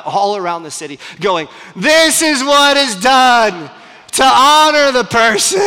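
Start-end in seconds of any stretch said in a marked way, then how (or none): 1.8-5.6 loud, high, drawn-out town-crier shout; proclaiming shout. Acted. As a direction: bellow it slowly across a whole city. Haman made to proclaim honor publicly.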